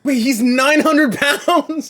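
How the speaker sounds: shocked shout. Real, not acted. Reaction to 900 pound man.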